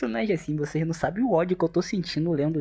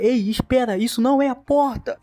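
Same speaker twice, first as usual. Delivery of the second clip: higher, louder call to stop; warning shout; 8 semitones higher, 5 dB louder than usual. Acted. Extra yelling at the hero not to walk into the glass. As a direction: yell to stop him before the door.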